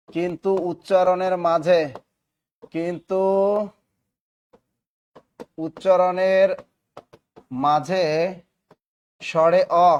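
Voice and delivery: long pauses between phrases; word-by-word dictation. Real, not acted. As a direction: dictate in small pieces, waiting for writers. (থেমে থেমে শ্রুতলিপি)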